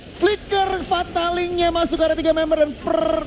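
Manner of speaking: hyped-up play-by-play, pitch way up; fighting game announcer. Real, not acted. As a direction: call the big fight at full hype. Climactic teamfight play-by-play call.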